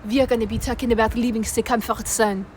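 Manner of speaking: hammed-up French accent, halting delivery; put-on French accent. Multi-talented vlogger showing off to viewers, mock French accent. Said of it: "I could do a French accent".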